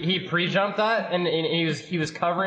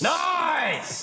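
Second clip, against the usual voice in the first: excited shout; 12 semitones higher than usual. Real, not acted. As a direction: yell it out after a goal. Goal celebration.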